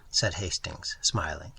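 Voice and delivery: ordinary delivery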